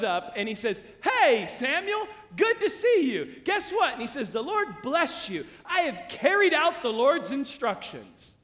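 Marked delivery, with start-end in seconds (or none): 1.0-3.9 mock-cheery loud, high hello; hammed-up glad-to-see-you; hearty greeting. Saul breezily greets Samuel in retelling. 4.3-8.3 mock-pious, raised voice; slow, emphatic show of obedience; self-satisfied boast. Saul boasts to Samuel in retelling.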